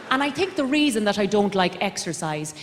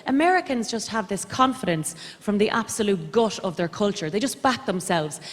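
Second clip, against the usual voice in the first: ordinary delivery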